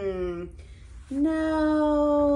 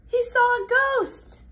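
high, startled cry; mock fright for the kids; surprised; 13 semitones higher than usual. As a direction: gasp it out as if frightened. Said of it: "it surprised Mister Pumpkin".

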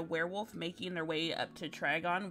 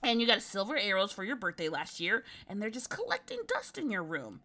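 high, breathless, tumbling words; excited rambling girl; 6 semitones higher, 5 dB louder than usual. Acted. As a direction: ramble it all out in a rush.

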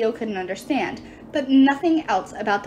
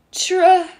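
straining to pronounce; 8 semitones higher, 8 dB louder than usual. Acted. Dwarf struggles with a name.

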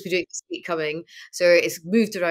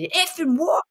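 high, hammed-up mock menace in Scottish; deadpan tough Scot, pitched up; straight-faced Scottish threat; 11 semitones higher than usual. Facing down kids, mock-menacing Scottish threat. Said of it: "I can do the proper straight face".

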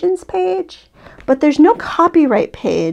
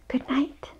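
breathy goodnight whisper, much softer; 14 dB quieter than usual. A narrator's reading. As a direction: whisper it over a sleeping child. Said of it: "heard Mama whisper".

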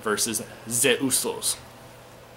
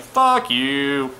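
crowd booing; 3 semitones higher, 7 dB louder than usual. Retold or imitated. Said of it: "the crowd will be like".

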